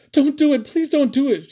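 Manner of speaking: high, loud, desperate pleading; Callie. A narrator's reading. Callie begging for her parrot's life, pleading loudly. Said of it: "she pleaded".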